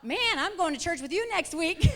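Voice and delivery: loud, high, hammed-up teen amazement; excited exclamation. Daughter to changed mother about church, excited. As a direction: exclaim it big, tipping into laughter.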